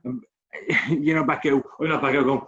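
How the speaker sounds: ordinary talk, nothing marked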